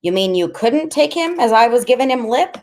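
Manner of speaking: high-pitched incredulity; incredulous question. A narrator's reading. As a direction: ask it high, in disbelief. Freak's shocked question in story.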